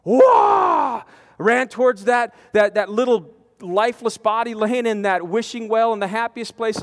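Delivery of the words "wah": loud, high burst of a cry; chaplain mode cry. Retold or imitated. Chaplain charging into action with a loud cry. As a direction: sound off as you spring into action. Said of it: "what I call chaplain mode".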